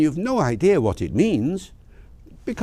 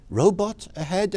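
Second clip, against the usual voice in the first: higher, rising question; puzzled echo of a road sign, pitched up; puzzled query; 7 semitones higher than usual. Acted. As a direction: query it in disbelief. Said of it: "I say".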